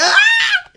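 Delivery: high yelp of fright; sudden scream. Real, not acted. Gamer hit by a mob, yelping. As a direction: yelp as you get hit.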